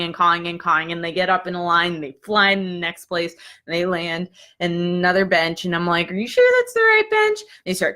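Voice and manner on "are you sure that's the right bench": high, squeaky-pitched doubtful question; incredulous question. Retold or imitated. Student asking crows about the bench, high and doubtful.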